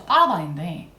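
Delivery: ordinary delivery, nothing marked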